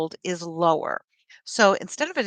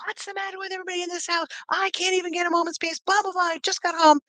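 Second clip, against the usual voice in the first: much higher, fast exasperated mock yell; mock screaming; 10 semitones higher, faster than usual. A stressed mom yelling at her family, mock frantic yell. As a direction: blow up at the whole house, hammed up. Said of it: "I'm screaming".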